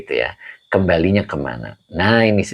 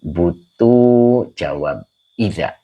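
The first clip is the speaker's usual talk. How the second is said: "jawab idza" stretched out for the class; slow and pointed on "jawab idza"; slow emphasis; much slower than usual. Shown as a demonstration. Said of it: "maka depannya dibaca jawab idza".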